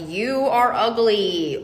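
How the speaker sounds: slow, drawn-out mock radio boom. Insult booming from a radio, mock booming voice. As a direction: boom it out slowly like a voice over a radio. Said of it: "someone booming over a radio".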